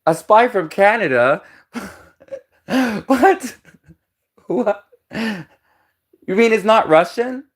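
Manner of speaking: high-pitched, laughing disbelief, imitating mocking Americans; hammed-up incredulous squeal between laughs; mock incredulous laughter. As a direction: act out scoffing Americans cracking up in disbelief. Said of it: "the Americans just start laughing, like".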